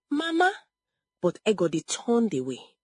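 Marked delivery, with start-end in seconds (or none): 0.1-0.5 high, rising small appeal; voice breaking. A narrator's reading. Rejected daughter appeals to her mother, voice breaking. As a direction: call to mother, lifting up high. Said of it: "Adaize's voice broke".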